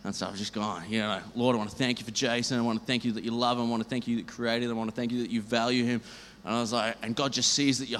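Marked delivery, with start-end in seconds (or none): 1.3-6.0 quick, raised prayer voice; praying fast over a stranger in a bar; rushed prayer. Retold or imitated. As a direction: rattle off blessings before he stops you.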